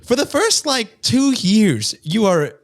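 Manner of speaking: high, astonished questioning; incredulous. Real, not acted. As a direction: pitch leaping up in disbelief. Outraged at baby's age.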